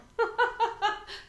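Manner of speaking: high, loud burst of laughter; amused laugh. Real, not acted. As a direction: laugh out loud at your own joke. Laughs at own swimming joke. (grand éclat de rire aigu)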